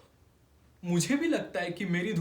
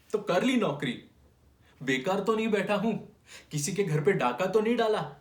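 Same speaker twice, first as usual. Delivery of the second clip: higher, heated protest; indignant self-defence; 6 semitones higher, 4 dB louder than usual. Acted. Clerk defending his job to the audience, indignant. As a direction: protest your honest living, pitch raised.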